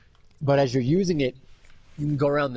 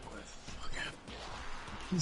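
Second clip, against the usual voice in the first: slow, faint mock-groggy whisper; whispered, drawn-out confusion; dazed whisper; 18 dB quieter, much slower than usual. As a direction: imitate someone coming to, barely voiced. Knocked-out man waking up dazed.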